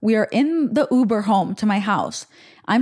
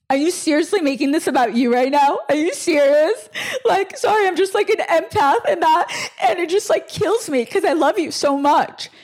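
high, hammed-up crying voice; mock tearful indignation; 7 semitones higher than usual. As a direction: imitate a friend sobbing as she protests. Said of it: "shouldn't I be the one crying".

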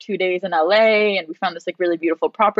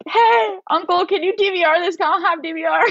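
high giddy request to uncle; bubbly rushed ask; thrilled niece calling her uncle; excited plea; 12 semitones higher than usual. Retold or imitated. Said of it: "I was like".

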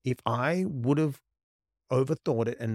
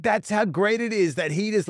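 proud excitement; 9 semitones higher, 6 dB louder than usual. Retold or imitated. Proud dad retelling son's remark.

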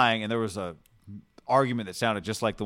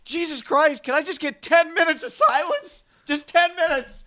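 angry outburst; 4 dB louder, slower than usual. Retold or imitated. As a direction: imitate an exasperated high-pitched yell. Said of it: "the guy who was angry just goes".

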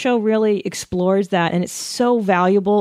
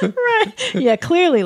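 laughing; 10 semitones higher, much slower than usual. Real, not acted. Laughing reply, much higher.

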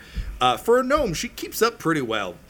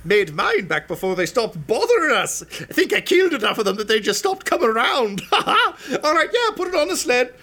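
gnome voice; 10 semitones higher, 4 dB louder than usual. Acted. Gnome asking the knight to lift the visor, high. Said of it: "She's like".

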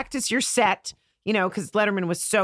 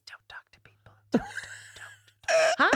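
urgent stage whisper; hushed daughter's warning; 3 dB quieter than usual. Retold or imitated. Daughter to mom in an elevator, whispered warning. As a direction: whisper it as an embarrassed child. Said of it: "she goes like this".

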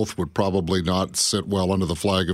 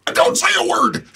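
high, loud mock shout; stern dad order; 5 dB louder than usual. Dad ordering his feuding daughters to sit silent, mock shouting. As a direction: burst out with a parent's command.

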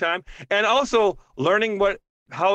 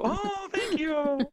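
touched; 11 semitones higher, 7 dB quieter, much slower than usual. Real, not acted. Touched by support.

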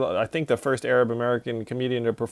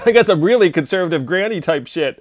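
laughing quote; 5 semitones higher, 6 dB louder than usual. Retold or imitated. Mock-quoting Michelle, laughing.

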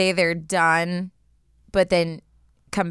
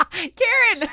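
laughing; 12 semitones higher than usual. Real, not acted. Laughing at mispronounced name.

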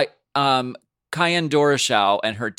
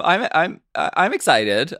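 ordinary delivery

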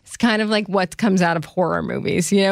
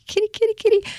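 high sing-song cat call; child calling a stray cat in a high coaxing voice; faster than usual. Retold or imitated.

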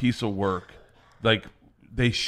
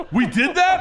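amazed laughing squeal; 6 dB louder than usual. Retold or imitated. Kids amazed by their own story.

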